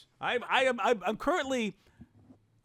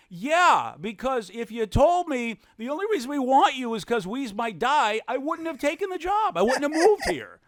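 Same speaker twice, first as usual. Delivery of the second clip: indignant retelling; 7 semitones higher, 3 dB louder than usual. Retold or imitated. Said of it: "I'm like".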